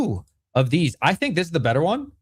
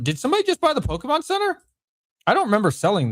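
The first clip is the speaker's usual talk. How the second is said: surprised; 11 semitones higher, faster than usual. Real, not acted. Notices an unexpected sale.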